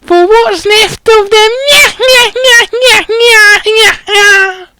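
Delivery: very high, sneering caretaker voice; cackling mean old caretaker; nasty caretaker. Acted. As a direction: gloat at the students in a squeaky old voice. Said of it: "he added nastily".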